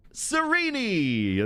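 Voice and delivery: high, drawn-out sing-song call of a name; excited greeting. Real, not acted. Streamer greeting a newly arrived chatter by name, drawn-out high call. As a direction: stretch the name out high and slow to greet them.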